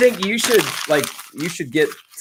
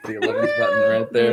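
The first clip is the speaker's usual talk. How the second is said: laughing high squeak. Real, not acted. Cracking up over the belly button.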